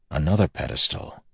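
plain reading voice

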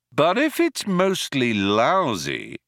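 measured, drawn-out reasoning; slow, deliberate. Acted. Ford reasoning with a dim guard, slowly. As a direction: give each word time to land. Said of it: "slowly giving the words time".

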